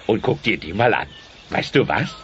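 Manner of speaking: ordinary talk, nothing marked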